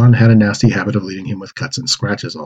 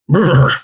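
shivering brrr; 7 semitones higher than usual. Acted. Jumps into cold river.

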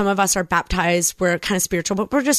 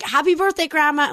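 retold tearful toast; 8 semitones higher than usual. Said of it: "I'm crying".

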